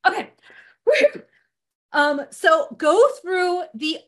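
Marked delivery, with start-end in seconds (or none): 0.8-1.2 high, loud whew; big relieved whew; loud whew of relief. Real, not acted. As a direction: blow out relief. Relief sigh after rambling.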